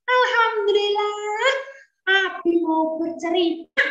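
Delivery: put-on high, quick child's voice; a boy answering his father eagerly. Young Ismail answering his father's call in a high, quick child voice.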